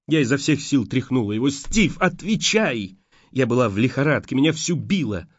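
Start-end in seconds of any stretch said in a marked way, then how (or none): 1.5-3.0 desperate plea. A narrator's reading. Shaking him, demanding answer.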